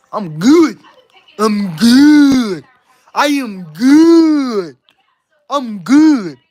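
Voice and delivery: GOOD held and swooping, emphatically drawn out; insist, dragging out every GOOD; drawn-out insistence. Real, not acted. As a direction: stretch GOOD out long and loud. Insists he is fine single.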